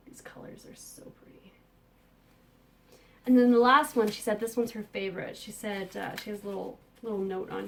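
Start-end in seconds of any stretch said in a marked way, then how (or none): 0.1-1.5 whispering. Real, not acted. Admiring yarn up close.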